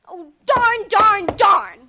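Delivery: loud, rising frustrated outburst. Acted. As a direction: stamp out the darns. Girl frustrated alone.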